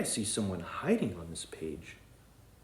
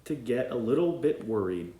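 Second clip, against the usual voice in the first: bright read-aloud storytelling voice; storybook narration; 3 semitones higher, 6 dB louder than usual. A narrator's reading. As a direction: read it like a bedtime book for kids. Reads story aloud to children.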